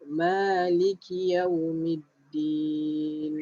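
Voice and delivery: slow, drawn-out tajwid recitation; slow Quran recitation. Shown as a demonstration. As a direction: recite it slowly for students to follow. (bacaan tajwid perlahan)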